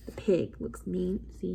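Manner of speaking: ordinary delivery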